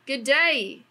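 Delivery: mock Australian greeting, lightly done; a breezy Aussie hello; Australian accent. Australian accent reading the brand's greeting aloud. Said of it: "kinda like an Australian".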